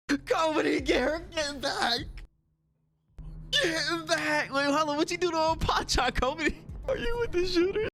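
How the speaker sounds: high, fast indignant yelling at the screen; scolding the characters in pretend panic; mock outraged.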